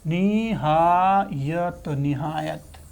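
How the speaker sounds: very slow, stretched word; drawn-out teacher model. Shown as a demonstration. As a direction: say it syllable by syllable, slowly. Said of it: "teacher models word slowly".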